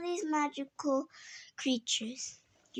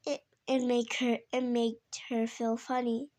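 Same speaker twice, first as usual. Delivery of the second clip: plain reading voice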